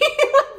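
sudden loud high laugh; burst of high laughter. Real, not acted. Reader bursts out laughing at her own reading. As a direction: crack up at the idea.

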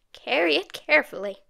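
put-on an old woman's voice with a ring of satisfaction; satisfied old grandmother. A narrator's reading. Grandmother taking the money, with squeaky satisfaction. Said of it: "Her final words had a ring of satisfaction".

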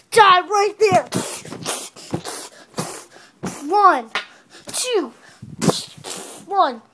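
booming fighting game announcer. Pretend. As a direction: call the fight like a referee. Kid announcing toy wrestling match.

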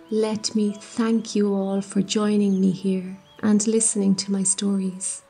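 usual speaking voice

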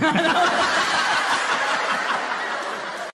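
helpless laugh after the punchline; laughing at own joke. Real, not acted. Friend telling jokes, cracks up at his punchline.

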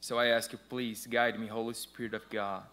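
ordinary talk, nothing marked

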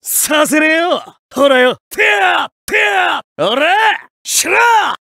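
sharp high attack yells; battle shouts. Acted. Fighter yelling at his opponent mid-attack, sharp battle shouts. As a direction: bark each strike out hard.

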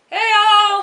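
high, loud cheerful call; squealing greeting; excited shout. Real, not acted. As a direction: hail the viewers with delight.

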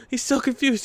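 normal talking voice

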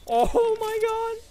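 stunned. Real, not acted. Reacting to surprise enemy.

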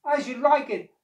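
high, loud incantation; cat making a wish. A narrator's reading. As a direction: call out a magic wish urgently. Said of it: "wished the cat".

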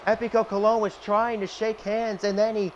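lively play-by-play announcer; wrestling commentator. Acted. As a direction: call the match like a TV announcer. Plays wrestling announcer over game.

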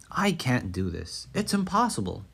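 usual speaking voice

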